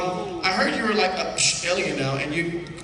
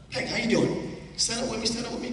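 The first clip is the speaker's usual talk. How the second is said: ordinary talk, nothing marked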